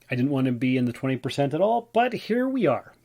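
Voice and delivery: normal talking voice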